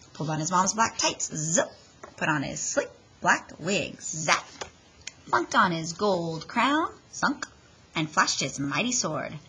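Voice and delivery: plain reading voice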